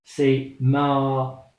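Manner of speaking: ma with the a held long; long vowel ma. Shown as a demonstration. Teacher shows mim with alif. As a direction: stretch the a in ma. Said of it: "mim avec alif".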